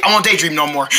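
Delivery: normal talking voice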